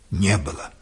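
plain reading voice

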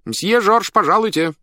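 high, loud call through a door. A narrator's reading. Someone calls Monsieur Georges to the stage, shouting. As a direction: shout to summon a performer, voice pitched high. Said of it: "крикнул кто-то за дверью".

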